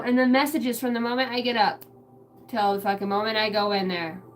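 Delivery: ordinary talk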